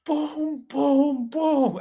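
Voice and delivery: high, falling booms, evenly spaced; Costco guys. Retold or imitated. Husband mimicking the Costco guys' rating, high chanted booms. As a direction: chant each boom high like a viral catchphrase. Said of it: "Jimmy Fallon and the Costco people".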